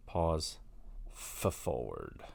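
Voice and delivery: ordinary talk, nothing marked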